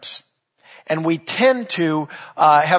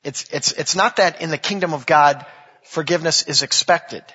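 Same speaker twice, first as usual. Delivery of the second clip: ordinary delivery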